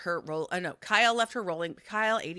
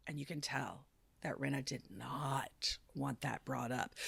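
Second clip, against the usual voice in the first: whispered, a touch slower; hushed aside; 4 semitones lower, 9 dB quieter than usual. Real, not acted. Whispering the juicy detail to her co-host. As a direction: drop to a gossipy whisper.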